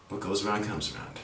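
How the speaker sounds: muttered low and soft to oneself; under the breath, privately; said to myself. Retold or imitated. Teacher murmuring the saying to herself about careless neighbors. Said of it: "I say to myself".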